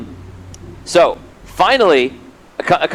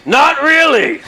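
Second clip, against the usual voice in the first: higher, laughing through the answer; laughing reply; 8 semitones higher, much slower than usual. Retold or imitated. The GM voicing a crew member's answer, higher and laughing. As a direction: toss it off with a laugh. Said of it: "And he's like".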